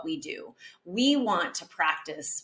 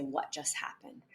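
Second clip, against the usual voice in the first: softer and lower, calm; calm after a breath; 4 semitones lower, 11 dB quieter than usual. Shown as a demonstration. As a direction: ask gently after taking a breath. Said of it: "then I'm gonna take a breath".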